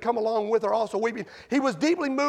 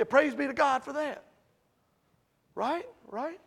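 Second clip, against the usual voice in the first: ordinary delivery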